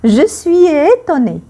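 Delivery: high surprised exclamation; mock astonished "Je suis étonnée"; acted astonishment. Shown as a demonstration. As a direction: gasp it out, astonished. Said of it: "quand on est surpris".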